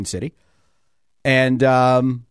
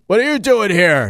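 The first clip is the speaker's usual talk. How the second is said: mock-indignant question, higher and louder; retold challenge; 9 semitones higher, 6 dB louder than usual. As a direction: imitate people loudly questioning a newcomer. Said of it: "And people were like".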